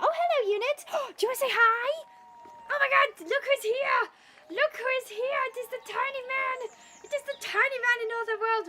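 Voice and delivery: high-pitched cooing at a beloved pet. Real, not acted. Streamer greets pet who came to say hi, high baby talk.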